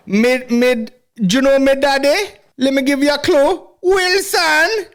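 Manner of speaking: high-pitched mock Jamaican patois; hammed-up Jamaican accent, way up high; squeaky party boast in Jamaican patois. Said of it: "He's at parties like".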